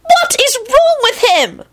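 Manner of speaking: very high, slow wail; acted shrieking dismay. A narrator's reading. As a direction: stretch it into a squealed protest. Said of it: "Rosie wailed".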